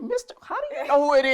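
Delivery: high, loud startled question; shocked disbelief. Retold or imitated. Telling how she learned his name, high startled. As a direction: squeak it out in surprise. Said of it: "I'm like".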